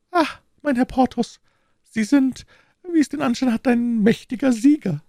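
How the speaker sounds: very high falsetto lady, reproachful; offended procurator's wife. Acted. Wife speaks privately. (gekränkte Frau in hoher Fistelstimme)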